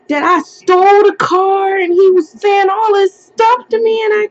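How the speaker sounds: high, loud, tearful rush of words; frantic retelling. Retold or imitated. Daughter telling her mom about the arrest, high and tearful. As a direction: pour it all out to mom in panic. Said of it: "No, I was going crazy".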